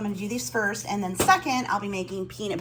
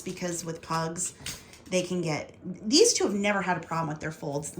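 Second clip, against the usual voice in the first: ordinary talk, nothing marked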